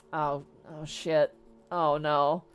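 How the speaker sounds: low, slow sinking realization; dawning dread. Retold or imitated. Warriors realizing what the meteor is, quietly dismayed.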